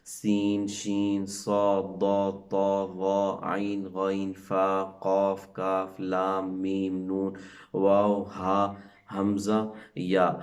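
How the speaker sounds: slow, careful letter-by-letter recitation; teacher reciting letters to a class; reciting the alphabet. Shown as a demonstration. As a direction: recite each letter clearly for children. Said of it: "اس میں جتنے بھی alphabet آتے ہیں".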